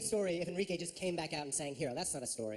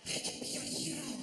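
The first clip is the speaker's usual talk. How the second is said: sultry whisper. Retold or imitated. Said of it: "He's just like".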